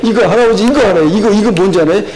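lightly voiced little child, laughing; a hint of a giggling toddler; child quoted. Grandpa quoting his laughing grandchild's request. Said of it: "그러면서 또 뭐 이제".

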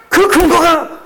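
high, loud preaching cry; preaching at full force. Acted. Pastor to congregation, crying out in full preaching force. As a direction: lift the congregation with a ringing cry. Preacher shouting his climax.

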